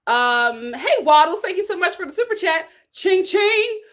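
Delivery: ordinary talk, nothing marked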